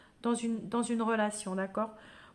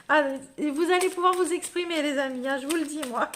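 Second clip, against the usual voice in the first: excited; 3 semitones higher, 4 dB louder, faster than usual. Real, not acted. Laughing it off after burp.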